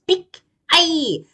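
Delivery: high, loud, slow cry of pain; stung yelp; ouch. Acted. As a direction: yelp as the sting hits. Bear is stung on nose.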